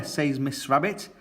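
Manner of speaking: plain reading voice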